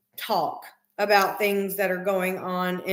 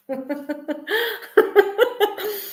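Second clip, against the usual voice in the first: bright high laugh; high burst of laughter; 10 semitones higher than usual. Real, not acted. Owner laughing at her dog after praising him with a high laugh.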